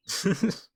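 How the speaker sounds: a high, loud burst of laughter; teasing laugh. Real, not acted. Wolf laughing at the bunny he teased, a loud high laugh.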